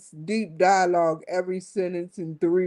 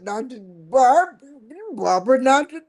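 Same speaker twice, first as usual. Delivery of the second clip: mock pleading; 3 semitones higher, 6 dB louder than usual. Staff begging boss, not today, loud mock plea. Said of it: "And they'd be like".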